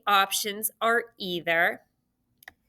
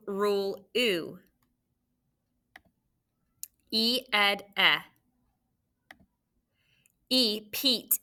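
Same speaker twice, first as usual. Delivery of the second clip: drill sounds call-out; slower than usual. Shown as a demonstration. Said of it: "Repeat after me".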